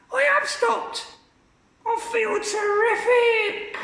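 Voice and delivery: put-on very high, slow screechy old woman. Grandma calling from the attic, very high screech. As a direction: screech it from above.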